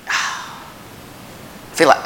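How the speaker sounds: unvoiced sigh of defeat; weary sighing whisper. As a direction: breathe it out like you failed. Sigh of feeling like a failure.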